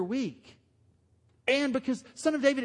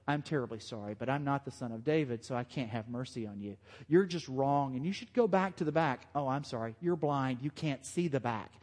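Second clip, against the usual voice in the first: mock apologetic Jesus; 7 semitones lower, 5 dB quieter than usual. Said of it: "Jesus doesn't respond with".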